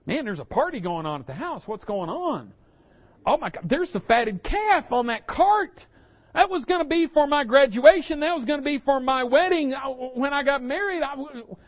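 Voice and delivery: voicing the elder son's rising indignation; a son seeing his own feast go to another; elder son, indignant. Elder son voiced discovering party.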